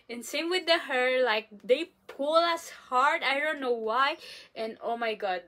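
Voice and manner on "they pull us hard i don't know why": ordinary delivery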